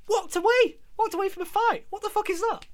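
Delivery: real exasperated outburst; outraged. Real, not acted. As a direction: pitch up in disbelief. Ranting about faces walking away.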